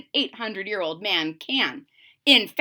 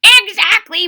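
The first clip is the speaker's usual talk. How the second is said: muttering; 9 semitones higher, 11 dB louder than usual. A narrator's reading. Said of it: "muttered the old man".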